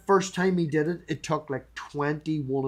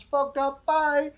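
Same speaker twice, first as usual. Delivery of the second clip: blurted high and louder, dismissive; mock curt sign-off; 12 semitones higher, 4 dB louder, slower than usual. Host mimicking his blunt style to viewers, high curt sign-off. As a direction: snap it off high like a quick brush-off. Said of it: "You can understand me".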